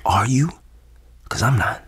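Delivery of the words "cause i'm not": unvoiced doubtful whisper. Whispering aside during call.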